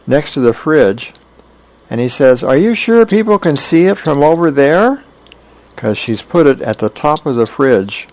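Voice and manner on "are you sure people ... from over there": put-on high-pitched, doubtful kid; child's question. Hammy on the counter questioning Mom, doubtful. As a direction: ask it high, like an unconvinced child.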